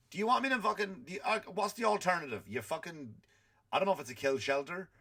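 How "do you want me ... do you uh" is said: usual speaking voice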